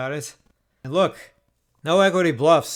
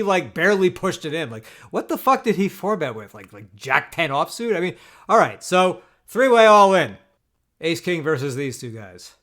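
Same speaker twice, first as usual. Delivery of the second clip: ordinary delivery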